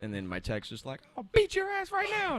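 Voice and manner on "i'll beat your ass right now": much higher, hammed-up tough-guy threat; mock threat. Tech threatening the taunting customer, hammed-up menace. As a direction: play the furious tech.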